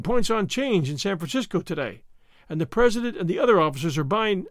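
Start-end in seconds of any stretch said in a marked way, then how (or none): none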